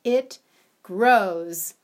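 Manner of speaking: loud, punched answer; reveal. A narrator's reading. Storyteller reveals the answer to the children, loud and emphatic. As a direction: land the punchline big for the children. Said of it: "Can you guess".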